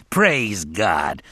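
mildly tearful praise; weeping praise. A narrator's reading. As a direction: offer praise while weeping. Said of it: "still weeping".

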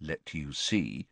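soft, slow, low aside; under his breath. A narrator's reading. Ron answering back behind mother's back, under his breath. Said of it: "he added under his breath".

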